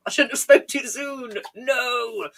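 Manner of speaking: high, wailing mock lament; hammed-up cry of defeat; mock despair. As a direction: wail it in playful despair, dragging out the "No". Mock lament at losing a viewer.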